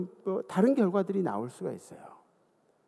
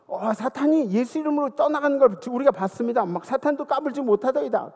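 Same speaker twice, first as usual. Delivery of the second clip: excited disciples; 6 semitones higher, 3 dB louder than usual. Retold or imitated. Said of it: "제자들이 막".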